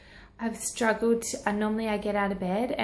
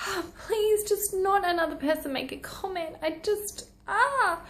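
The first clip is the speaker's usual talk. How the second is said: much higher mock exasperation; imagined weary plea, pitched way up; fed up; 8 semitones higher than usual. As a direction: play it as worn out by comments. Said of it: "And you must be like".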